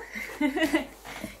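high, giddy laugh; overwhelmed laugh. Real, not acted. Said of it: "Getting a bit overwhelmed".

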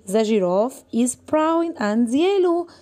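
very slow, word-by-word classroom reading; slow reading aloud. Shown as a demonstration. Teacher reading the lion text aloud to the class. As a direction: read it out slowly for young learners. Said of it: "reading text to pupils".

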